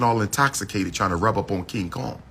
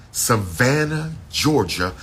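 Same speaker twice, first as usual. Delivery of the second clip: drawn-out Savannah; slower than usual. Real, not acted. Promoting the ball to followers, slow drawn-out Savannah. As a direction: draw out Savannah like a hype call. Repeating the event city, stretched.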